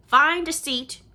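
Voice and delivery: loud, high sharp command; sharp order. A narrator's reading. Dedicate ordering the newcomer to sit, sharp loud command. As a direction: snap the order across the hall. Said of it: "her voice sharp".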